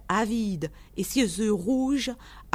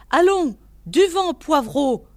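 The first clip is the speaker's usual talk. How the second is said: loud, high-pitched scolding; angry growl; 5 semitones higher, 7 dB louder than usual. A narrator's reading. Old man at the window chases off a knocker, growling. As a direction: send the caller packing, loudly. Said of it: "gronda une voix".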